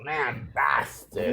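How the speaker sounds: lambasted drawn out in a mock posh accent, soft. Shown as a demonstration. To friends, trying out the old word, in a mock posh accent. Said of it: "supposed to say it in, like, that accent".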